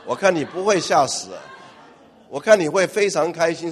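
usual speaking voice

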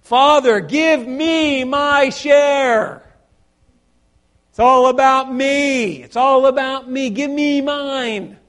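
greedy son shouting at father for his share; demanding. Acted. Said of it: "What did he start out by saying".

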